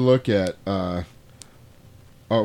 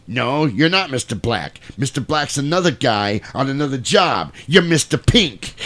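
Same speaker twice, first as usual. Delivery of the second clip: Joe from Reservoir Dogs; 4 semitones higher, 3 dB louder than usual. Retold or imitated. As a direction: lay down the law, hammed up. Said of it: "Lawrence Tierney ... was Joe from Reservoir Dogs".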